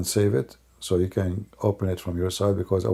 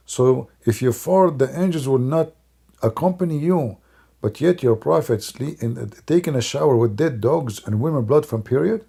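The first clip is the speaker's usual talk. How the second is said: normal talking voice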